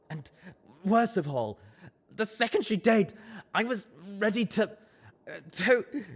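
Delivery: strangled by sobs, straining; choking up. Acted. Colt confessing his shame, choked and strained. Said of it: "he choked like he was being strangled".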